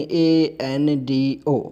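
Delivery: slow, letter-by-letter spelling of ए एन डी ओ; spelled letter by letter. Shown as a demonstration. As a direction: spell out each letter ए, एन, डी, ओ slowly. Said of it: "यानी".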